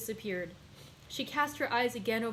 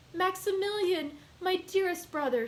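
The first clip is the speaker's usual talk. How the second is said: joyful cry; 9 semitones higher than usual. A narrator's reading. Sister greeting her returning brother with joyful cry. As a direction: cry out his name with joy. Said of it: "she cried".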